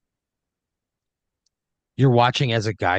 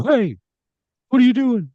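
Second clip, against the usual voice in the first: much higher, louder call; challenging shout; 13 semitones higher, 7 dB louder than usual. Acted. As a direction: yell after someone running off. Voices someone yelling after the player.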